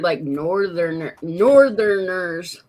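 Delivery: ordinary talk, nothing marked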